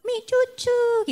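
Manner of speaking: put-on high, slow, babyish toddler voice. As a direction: do the little child asking for milk on waking. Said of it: "biasa kan anak ini setiap bangun tuh".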